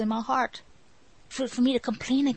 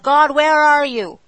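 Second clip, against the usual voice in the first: slow, loud plea thrown up to heaven, much louder; loud pleading cry; 4 semitones higher, 10 dB louder, slower than usual. Retold or imitated. Believer calling out to God in frustration, loud slow plea. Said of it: "we start waving our hand at God".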